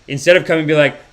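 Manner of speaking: ordinary talk, nothing marked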